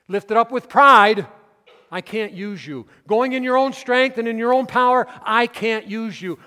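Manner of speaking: loud, high, emphatic preaching; forceful refusal. Acted. Preacher voices Christ refusing a proud Peter, forceful. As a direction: thunder it as a firm rejection.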